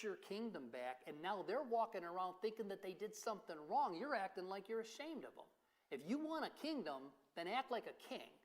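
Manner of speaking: acted-out indignant dressing-down, pitch climbing as it goes; stern rebuke. Joab kicks the door in on grieving David, stern rebuke. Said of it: "Joab comes and kicks the bedroom door in and says".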